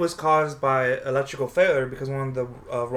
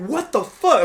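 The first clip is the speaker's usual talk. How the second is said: retold scream; 11 semitones higher, 6 dB louder, slower than usual. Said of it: "like, screaming".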